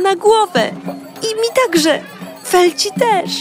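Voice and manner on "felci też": ordinary delivery, nothing marked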